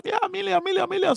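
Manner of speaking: high, eager crowd cry of million; mock eager shout. Audience shouting million at the speaker's question. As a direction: chant it like an overeager crowd. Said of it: "Everybody will shout".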